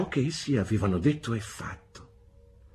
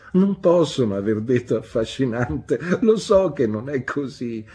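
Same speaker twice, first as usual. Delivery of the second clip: loud, amused protest from the mother; laughing exclamation; 4 semitones higher, 7 dB louder than usual. A narrator's reading. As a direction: protest loudly, as if half laughing. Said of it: "esclamò ridendo". (protesta divertita ad alta voce)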